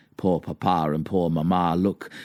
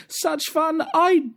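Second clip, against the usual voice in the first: slow, extremely high wail; drawn-out squeal of indignant dismay; hammed-up storybook wife wailing in high falsetto; crying out; 4 dB louder than usual. A narrator's reading. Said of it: "she cried with spoon in hand".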